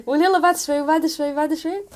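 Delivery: high, loud protest through laughter; laughing mock protest. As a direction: plead it off, laughing and rising. Acts out putting off prayer, laughing.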